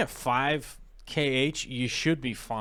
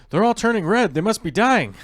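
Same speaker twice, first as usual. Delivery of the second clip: high, loud fake panic; mock panic; 10 semitones higher, 10 dB louder than usual. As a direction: squeal it like a freaked-out owner. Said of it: "It's like".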